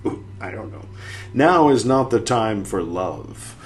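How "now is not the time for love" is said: slow declaiming quote. Acted. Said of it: "End quote".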